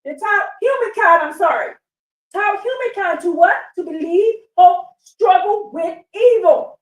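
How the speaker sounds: high, fired-up preaching with a few bursts of loud shouting; carried away by the reading, voice up high; real excitement, close to shouting; excited preaching. Real, not acted. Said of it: "I get excited".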